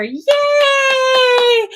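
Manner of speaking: high, loud squeal of joy; cheering yay. Real, not acted. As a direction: cheer for her new job. Celebrating a viewer's new job.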